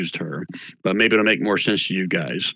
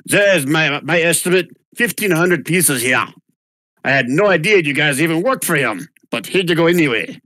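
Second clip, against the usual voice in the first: put-on German accent; 6 semitones higher than usual. Noblewoman paying the heroes, German accent. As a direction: hand over the coins with a thick German accent. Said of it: "I get to speak my German".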